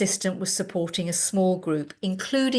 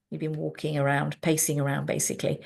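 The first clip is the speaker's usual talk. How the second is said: ordinary talk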